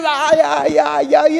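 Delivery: wailing like a hurt boy, lightly acted; retold childhood crying. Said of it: "empecé a llorar y a agarrarme y a gritar". (quejido infantil imitado, algo más agudo)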